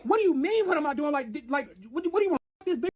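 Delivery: put-on confronting the boyfriend in front of thousands, angry; retold furious confrontation. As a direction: demand answers, pitch way up.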